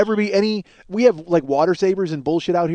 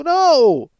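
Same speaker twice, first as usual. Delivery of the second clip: firm refusal; 9 semitones higher, 5 dB louder than usual. Retold or imitated. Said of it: "She'll go".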